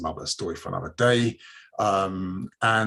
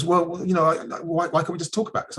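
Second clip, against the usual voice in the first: higher-pitched, stammering stall; mock hedging, pitched up and halting; reluctant driver; 8 semitones higher than usual. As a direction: imitate a driver wriggling out of it. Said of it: "he's really reluctant to give me his details".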